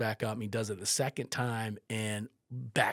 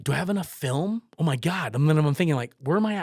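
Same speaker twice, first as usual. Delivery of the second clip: loud, high, flustered self-questioning; re-enacted panic; 6 semitones higher, 10 dB louder than usual. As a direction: fret out loud as if mid-crisis. Panicking over film, retold.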